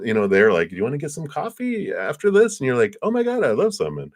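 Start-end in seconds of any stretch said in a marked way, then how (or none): 0.7-2.6 high, hopeful coffee invite; eager, lifted question; excited invitation. Acted. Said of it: "See how excited I am?". 3.0-4.0 high-pitched, thrilled yes; delighted and eager; excited acceptance. Acted. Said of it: "See how excited I am?".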